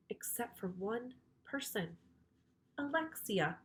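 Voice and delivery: hushed suspense whisper; storyteller whispering a teaser. Acted. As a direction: lean in and whisper the secret. Building suspense.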